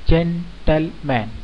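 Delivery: जेंटलमैन slowly broken into जेन, टल, मैन; three-syllable split. Shown as a demonstration. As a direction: say जेंटलमैन in three separate beats. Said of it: "यानी ये तीन खंडों में विभाजित है".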